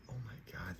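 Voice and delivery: hushed gasp of dismay; whispering. Retold or imitated. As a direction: breathe it out in horror. Retold panic after the car wreck.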